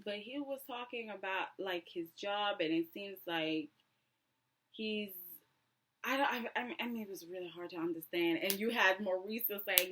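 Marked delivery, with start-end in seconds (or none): none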